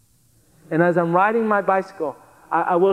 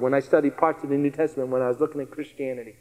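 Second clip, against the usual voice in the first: quiet aside; 5 semitones lower, 5 dB quieter, faster than usual. Real, not acted.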